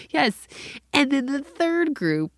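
high, loud, slow build; scandalized kid's report. Retold or imitated. Daughter telling mom about third group, high incredulous voice. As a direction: imitate a daughter drawing out the scandal.

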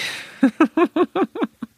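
a high squeaky giggle; nervous high giggle; high laugh. Real, not acted. Laughing at own stress.

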